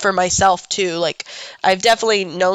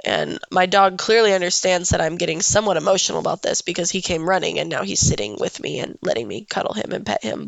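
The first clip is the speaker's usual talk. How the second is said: ordinary talk, nothing marked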